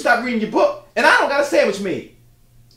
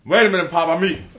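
talking with food in mouth; mouth full; 5 semitones lower, faster than usual. Acted. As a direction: speak around a bite of food. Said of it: "talking about food in your mouth".

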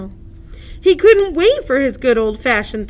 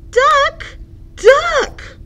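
high, louder calling out; surprised cry; 5 dB louder than usual. Acted. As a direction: call out startled. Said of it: "cried Hippo".

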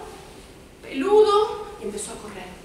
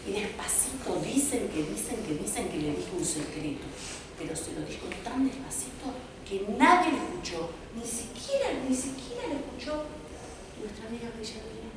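hushed; 3 semitones lower, 8 dB quieter than usual. A narrator's reading. Telling the secret part softly.

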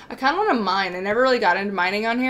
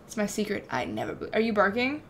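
ordinary delivery

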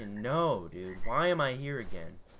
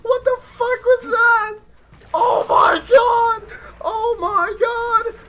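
1 terrified screaming; 13 dB louder, slower than usual. Real, not acted. Unknown creature attacks.

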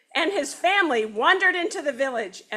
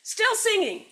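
snapped; 10 semitones higher than usual. Acted. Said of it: "snapped Don Pepe".

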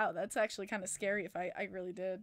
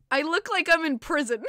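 quoted joke; 5 semitones higher, 8 dB louder than usual. Retold or imitated. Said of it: "legit says to me".

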